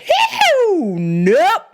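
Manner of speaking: retold incredulous laughing no; laughing refusal. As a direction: burst out cackling as you refuse.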